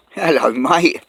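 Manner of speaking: loud, delighted hello to a passing dog; greeting a dog. Real, not acted. Greeting a bouncy dog on his walk, loud and delighted. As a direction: cheerfully call out to the big dog.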